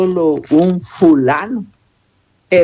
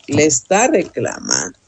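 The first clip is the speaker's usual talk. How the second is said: ordinary delivery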